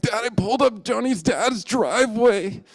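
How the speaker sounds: high, wobbling wail of confession; mock sobbing. Bawling boy confesses driveway to dad, hammed-up sobs. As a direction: blubber it out like a guilty kid. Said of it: "sobbing uncontrollably".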